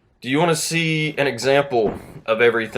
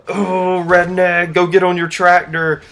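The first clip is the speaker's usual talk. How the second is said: put-on high, loud heckling kid voice; hammed-up childish taunt, pitched up; mocking twelve-year-olds; 7 semitones higher, 5 dB louder than usual. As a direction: imitate jeering kids piling on. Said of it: "all their twelve-year-old friends are".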